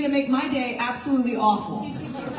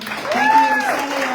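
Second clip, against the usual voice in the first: high, loud burst of thanks; excited thanks; 7 dB louder than usual. Real, not acted. Party chair thanking the state senator, high and loud. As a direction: call out your gratitude across the room.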